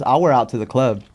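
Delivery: usual speaking voice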